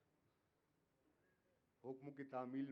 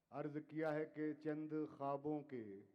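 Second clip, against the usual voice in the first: measured, declaiming poetry to audience; ghazal recitation; 4 dB louder, slower than usual. Acted. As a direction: recite each couplet with weight. Poet recites ghazal couplets.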